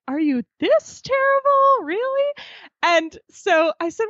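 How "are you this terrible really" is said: squeaky imitation of astonished dad; mock disbelief. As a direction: ham up dad's shock at her ignorance.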